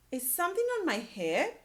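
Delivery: worried question. Acted. Teacher voicing Gerald, worried.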